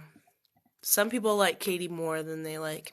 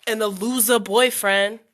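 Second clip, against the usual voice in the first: much louder, higher, laughing; loud laughing shout; 4 semitones higher, 13 dB louder than usual. Real, not acted. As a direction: blurt it out with a laugh.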